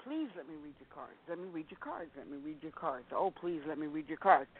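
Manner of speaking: soft, pleading mimicry of an ex-husband; mock begging. Ex-husband pestering her to read her cards, softly pleading. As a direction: quietly beg over and over. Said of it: "beg me".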